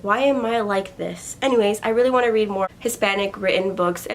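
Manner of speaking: ordinary talk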